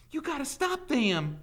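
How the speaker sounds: high, loud begging; urgent plea. A narrator's reading. Teacher urging sheriff to stop the mob, desperate. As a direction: implore him to step in. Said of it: "urged Catherine".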